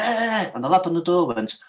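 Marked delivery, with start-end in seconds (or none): 0.0-0.5 high, sharp yell; retold sudden shout. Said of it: "And he went".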